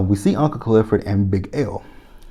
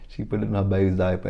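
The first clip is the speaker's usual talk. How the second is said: laughing; 4 dB quieter, faster than usual. Real, not acted. Repeats it while laughing.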